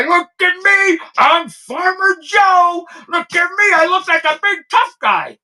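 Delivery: sneering parody of a puffed-up farmer playing hard; mock tough guy. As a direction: puff up and strut like a wannabe tough guy, mocking him. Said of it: "you can act like a big tough guy".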